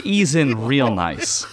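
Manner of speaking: very slow, drawn out; slow and smooth. Pretend. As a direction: ease into it slowly. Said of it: "ease into this podcast real nice".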